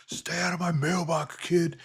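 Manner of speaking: intimidating dad voice; best dad voice. Retold or imitated. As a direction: bark it like an angry dad warning a kid off. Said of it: "in, like, my best dad voice".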